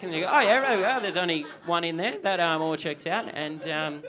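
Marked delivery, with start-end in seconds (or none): 0.3-3.3 loud, hammed-up approval; mock inspection. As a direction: announce the findings loudly. Acted inspection at the party.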